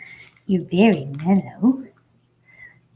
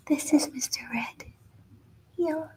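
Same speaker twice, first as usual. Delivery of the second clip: soft whisper; 5 semitones higher, 8 dB quieter than usual. Real, not acted. Hushed intro of puppy.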